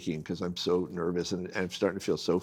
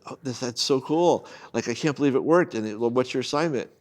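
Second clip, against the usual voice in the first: excited friends; 5 semitones higher, 4 dB louder than usual. Retold or imitated. Friends gush about the news.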